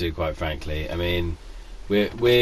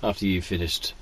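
ordinary talk

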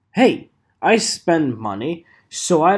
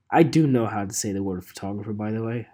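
laughing whisper; 6 semitones lower, 3 dB quieter, faster than usual. Real, not acted. Laughing aside.

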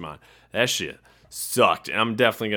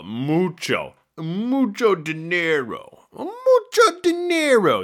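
bad mock Spanish accent, slow, climbing high at the end; hammed-up fake Spanish accent, slow; terrible Spanish accent; 7 semitones higher, slower than usual. People saying the cards will be worth money, in a terrible mock Spanish accent. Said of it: "That's a terrible accent".

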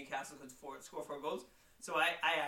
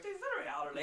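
much higher, mock-confident friend's voice; imitating his friend. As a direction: parrot your friend's bold prediction. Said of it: "you were like".

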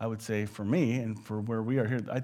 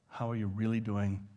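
lower, softer caring question; gentle sincere question; 4 semitones lower, 5 dB quieter than usual. Retold or imitated. Preacher showing a gentle question to someone hurting. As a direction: ask gently, really wanting to know.

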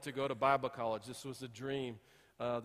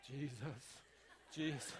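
near-silent pleading Jesus, much softer; desperate murmur; 2 semitones lower, 13 dB quieter, slower than usual. Retold or imitated. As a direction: breathe the name out, worn out and desperate. Hiding desperate in the closet.

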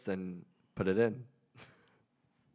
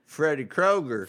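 loud, high, slow Southern drawl; mock Southern drawl; 6 semitones higher, 5 dB louder, slower than usual. Shown as a demonstration. Friend tries Freddy's name in a mock Southern drawl. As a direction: drag out KROGER in a put-on Southern accent. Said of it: "like that Southern drawl".

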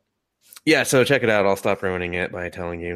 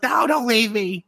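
high, drawn-out mock plea; panicked cat; 3 dB louder than usual. Stuck cat begs as the man leaves, high slow wail. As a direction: beg in a stretched, high cat voice. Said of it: "And he's like".

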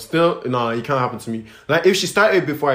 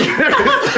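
high, bursting laughter; helpless high cackle; loud laugh; 5 dB louder than usual. Real, not acted. Laughing at own scenario.

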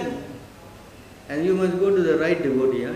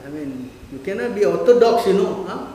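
loud, higher, scornful jeer of someone scolding a devotee; mocking a critic; 4 semitones higher, 5 dB louder than usual. Teacher imitating worldly people scorning a strict devotee, loud jeering. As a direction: sneer "fanatic" at him like an outsider. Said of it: "You speak like that".

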